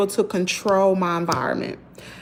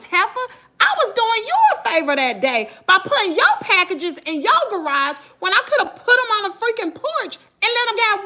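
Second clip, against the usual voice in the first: high, outraged retort, much higher pitched; indignant retort; 11 semitones higher than usual. Retold or imitated. Mail carrier snapping back at the neighbor, high and outraged. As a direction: tell off the neighbor who called her a thief.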